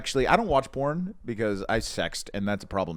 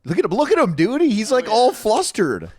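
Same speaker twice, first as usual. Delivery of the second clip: excited teasing; 10 semitones higher, 8 dB louder than usual. Real, not acted. Excited teasing at flustered friend.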